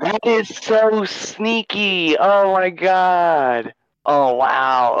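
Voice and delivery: high, loud, slow disbelief; drawn-out stunned admiration; amazed. Real, not acted. DM marveling at the player's sneaky command.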